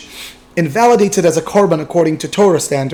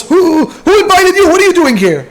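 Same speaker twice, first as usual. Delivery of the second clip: yelling; 14 semitones higher, 6 dB louder than usual. Retold or imitated. Party host yelling at the uninvited guest, high outraged shout. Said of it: "he looks at him and yells".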